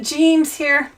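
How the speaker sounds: ordinary talk, nothing marked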